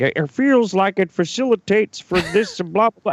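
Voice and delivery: high put-on voice of a vague lecturer; mocking imitation. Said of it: "He's like".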